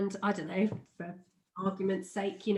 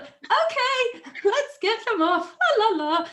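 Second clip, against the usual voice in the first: high, bright, louder sing-song with a laugh, hiding panic; fake breezy singsong; 13 semitones higher, 6 dB louder than usual. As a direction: chirp it like nothing's wrong. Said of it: "I was like".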